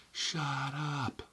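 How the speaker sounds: high, stretched-out shut up; mock yell at the TV. Fed up with cable news pundits, a high mock yell. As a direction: snap shut up at the talking heads, not loud. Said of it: "I'm screaming as loud as I can".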